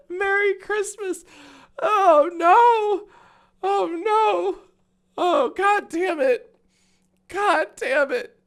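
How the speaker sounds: high, slow, tearful laughing groan. Real, not acted. Streamer crying with laughter at a scam tweet. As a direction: wail it through helpless laughter.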